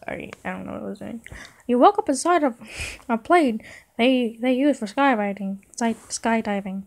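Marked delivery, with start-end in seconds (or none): 1.7-6.8 higher squeaky cartoon mouse; Mickey Mouse. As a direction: put on a high cartoon-mouse voice. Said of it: "read this in Mickey Mouse voice".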